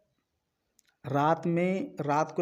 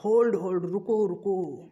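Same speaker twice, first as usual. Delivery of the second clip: half-hearted cry to halt someone; mock cry; 3 semitones higher than usual. Teacher voicing heaven crying out to stop the knife, mock cry. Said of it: "To cry चिल्लाएगा".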